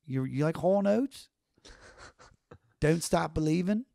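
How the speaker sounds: lightly voiced Baltimore accent with rounded O's; a hint of a Baltimore twang. Shown as a demonstration. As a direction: roll the O's like a Baltimore local. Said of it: "roll my O's and be like".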